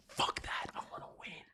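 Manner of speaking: breathy whisper, almost silent; whispered defiance. Retold or imitated. Reacting to the coach benching him, a whispered protest. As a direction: hiss it under your breath, defiant.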